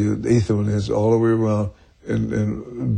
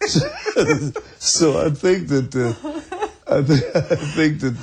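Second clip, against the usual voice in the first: laughing; 5 semitones higher than usual. Real, not acted. Laughing after telling the story.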